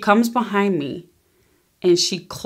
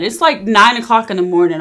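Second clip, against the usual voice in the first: normal talking voice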